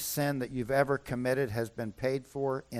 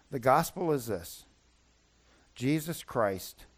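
gentle gospel summary; 2 semitones lower than usual. Acted.

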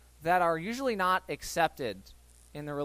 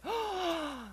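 high shocked gasp; mock gasp; 11 semitones higher, 5 dB quieter than usual. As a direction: gasp like a scandalized crowd.

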